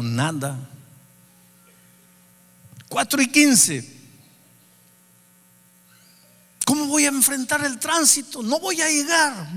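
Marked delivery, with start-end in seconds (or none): 2.9-9.5 nervioso. Retold or imitated. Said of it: "Me comencé a poner nervioso".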